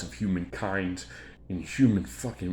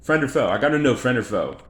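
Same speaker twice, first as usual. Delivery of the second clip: loud, raised-pitch insistence; urgent demand; 5 semitones higher, 9 dB louder than usual. Real, not acted. Sizing up people's intentions, loud and urgent. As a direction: burst out with it, pressing hard.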